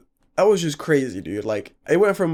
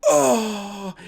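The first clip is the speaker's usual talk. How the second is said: higher groan sliding down; imitated moan; 6 semitones higher than usual. Friend asked to look up, imitated moan. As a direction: mimic a dazed friend's groan. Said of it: "He's just moaning".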